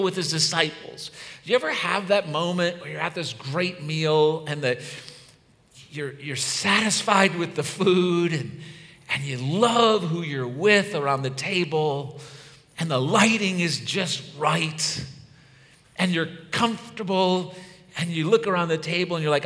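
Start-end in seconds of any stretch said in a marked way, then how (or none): none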